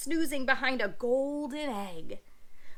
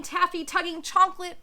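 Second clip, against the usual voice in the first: usual speaking voice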